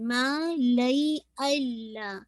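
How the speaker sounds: मा लई अल्ला with each vowel held two counts; slow recitation. Shown as a demonstration. Teacher reciting two-count stretches slowly. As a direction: stretch मा and लई evenly. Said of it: "मुस्तकिल टू टू count".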